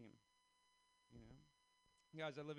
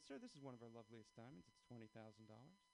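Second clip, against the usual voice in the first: smooth saleswoman; 4 semitones lower, 8 dB quieter than usual. Retold or imitated. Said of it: "She said".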